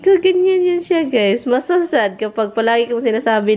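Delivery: normal talking voice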